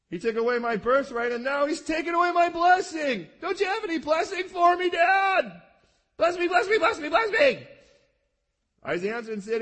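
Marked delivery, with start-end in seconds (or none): 0.1-7.7 high shouted begging; desperate shout. Acted. Esau shouting at his father for a blessing, desperate and loud. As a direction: beg at full voice like a cheated son.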